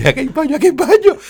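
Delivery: high loud frantic calling out; urgent excited yell. Retold or imitated. Finding a bathroom in a hurry, frantic high yell. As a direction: yelp it like you're about to burst.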